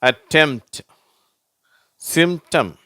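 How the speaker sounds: அட்டெம்ப்ட், சிம்டம் with M straight into T; silent P. Shown as a demonstration. Teacher reads examples for learners, silent P. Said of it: "அந்த பீன்ற உச்சரிப்பு வரதில்லை".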